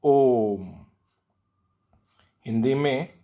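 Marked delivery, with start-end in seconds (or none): 0.0-0.9 "ओम" with the o held about three times as long as usual; drawn-out Om. Shown as a demonstration. Teacher showing a pluta vowel to pupils, a long drawn-out "ओम". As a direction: let "ओम" ring out, stretching its vowel. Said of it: "उदाहरण के लिए".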